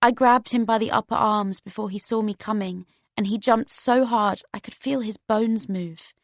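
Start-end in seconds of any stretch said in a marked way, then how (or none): none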